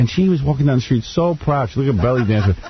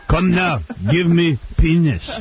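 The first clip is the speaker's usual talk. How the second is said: higher, slow mock German demand; mock German accent; 6 semitones higher, slower than usual. Friend joining the German girl bit, mock German accent. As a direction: order it stiffly in German style.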